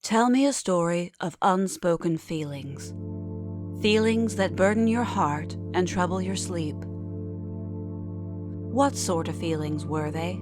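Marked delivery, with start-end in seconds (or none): none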